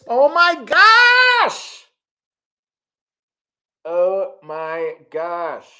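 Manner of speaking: gasps, then a very high, slow, drawn-out "oh my gosh"; overwhelmed, gasping with joy; gasping delight. Real, not acted. Gifted memberships surprise.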